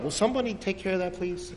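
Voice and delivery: quick, hushed aside; discreet complaint. Acted. Men muttering about baby in restaurant.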